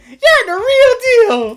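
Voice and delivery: very high, slow cartoon squeal; hammed-up high host voice; squeaky exaggerated praise. Exaggerated character line.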